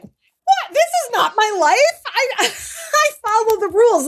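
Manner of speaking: high, incredulous outburst with a laugh; stunned disbelief. Retold or imitated. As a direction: voice leaps up in shocked disbelief, laughing at the absurdity. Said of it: "it was like".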